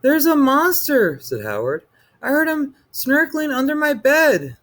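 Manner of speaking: put-on high little boy voice, urgent; a scared boy telling his mother; frightened child. A narrator's reading. Child reports monster to mommy.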